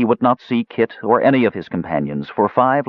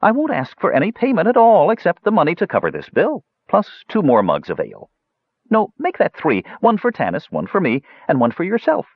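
high-pitched dwarf making an offer; kindly huckster; 11 semitones higher than usual. A narrator's reading. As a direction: wheedle generously in a lifted voice. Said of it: "quickly added in a kindly voice".

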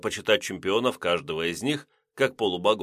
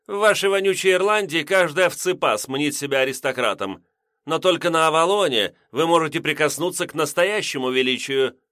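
put-on drunk sneering girl; 6 semitones higher, 4 dB louder than usual. A narrator's reading. Drunk Mary sneers at him.

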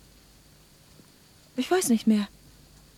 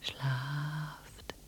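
lulling whisper; 11 dB quieter, much slower than usual. Acted. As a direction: whisper them into sleep. Anna puts them to sleep. (sanftes Flüstern)

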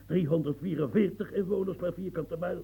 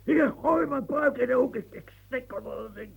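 rip the wig off in fury; exasperated cry; 7 semitones higher than usual. Acted. Old man complains to his servant, exasperated.

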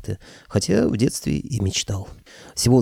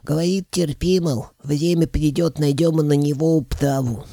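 raised, piping creature voice; high dragon head; 6 semitones higher than usual. A narrator's reading. Dragon head reporting Koschei's answer, high-pitched.

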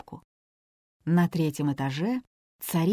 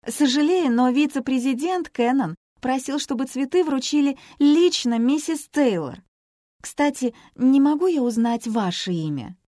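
put-on firm young woman; 6 semitones higher, 4 dB louder than usual. A narrator's reading. Dana pressures receptionist.

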